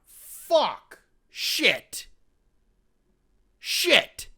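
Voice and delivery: high, loud one-word exclamations; re-enacted outburst at a surprise reveal; retold shocked cursing. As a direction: blurt each curse high and separately, dropping off sharply. Reacting to surprise director credit.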